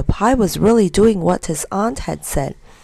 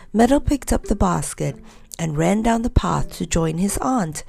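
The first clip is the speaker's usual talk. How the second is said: usual speaking voice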